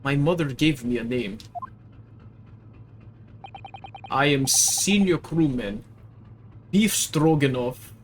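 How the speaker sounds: put-on broken English in a heavy Russian accent; proud Russian crewman correcting the girl; gruff Russian sailor. Sailor to the maid, Russian accent, offended.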